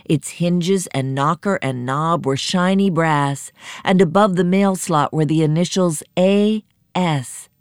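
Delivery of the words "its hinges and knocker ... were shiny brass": ordinary delivery, nothing marked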